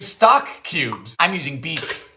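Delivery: usual speaking voice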